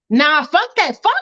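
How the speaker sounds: usual speaking voice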